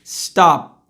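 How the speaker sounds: "stop" with lips shutting on the p; closed-mouth ending. Shown as a demonstration. Teacher closing his mouth on the p of "stop". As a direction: shut the mouth on "stop", let no air out. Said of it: "eu vou fechar a boca".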